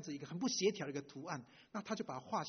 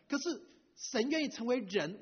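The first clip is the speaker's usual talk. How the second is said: higher and louder, slowed right down; emphatic, slow; 7 semitones higher, 5 dB louder than usual. Real, not acted. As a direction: lean on every word of the point. Preacher stresses God's choice. (加重语气，放慢强调)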